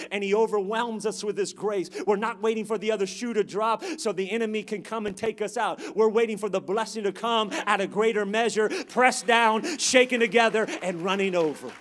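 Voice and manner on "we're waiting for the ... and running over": loud, building phrase by phrase; loud, rhythmic pulpit delivery; full-force preaching. Real, not acted. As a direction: preach a rising list of blessings.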